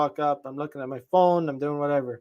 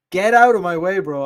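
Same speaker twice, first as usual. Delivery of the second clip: louder, heated order to move; mock yell; 3 semitones higher, 6 dB louder than usual. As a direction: shout him out of the way. Imagined shove-off at a blocker.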